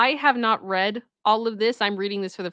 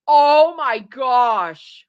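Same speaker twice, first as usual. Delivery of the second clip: delighted shock; 3 semitones higher, 8 dB louder, much slower than usual. Real, not acted.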